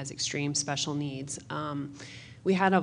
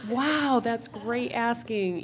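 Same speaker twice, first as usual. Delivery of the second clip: high, sing-song mock praise; a parent's bright praise to the child after she asks nicely; exaggerated praise; 7 semitones higher, 4 dB louder, slower than usual. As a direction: overdo the praise like a cheerful mom.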